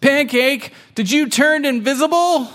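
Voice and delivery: high-pitched, astonished question; high, hammed-up concern; concerned kitten. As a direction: ask in alarmed kitten wonder. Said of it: "gets really concerned".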